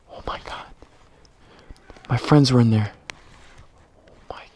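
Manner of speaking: breathless scared whisper; terrified whisper. Real, not acted. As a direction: whisper in shock. Hiding near shooter.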